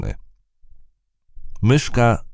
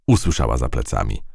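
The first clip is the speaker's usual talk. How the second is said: ordinary delivery, nothing marked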